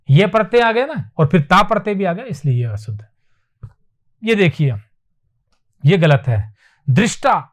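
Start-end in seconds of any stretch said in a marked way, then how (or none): none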